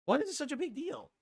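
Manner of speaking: high, strained protest with the voice cracking. Real, not acted. Streamer insisting hair loss is no big deal, voice cracking. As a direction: let the voice jump high and crack. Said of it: "his voice cracked quite a bit there".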